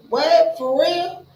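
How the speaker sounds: high, loud "What? For real?"; shocked disbelief. Retold or imitated. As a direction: jump up in pitch, stunned. Shocked at friend's choice.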